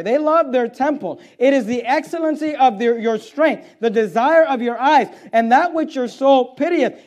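loud, high, emphatic sermon delivery; forceful preaching. Acted. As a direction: press the point on the congregation, building each phrase.

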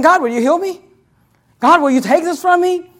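acted pleading. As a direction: plead with God as the suffering apostle. Acts out Paul begging God.

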